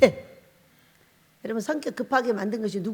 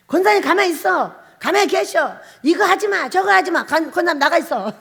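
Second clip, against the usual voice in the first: higher, louder string of commands; bossy orders; 6 semitones higher, 6 dB louder than usual. Retold or imitated. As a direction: chase the deaconess out of the room. Ordering the deaconess out.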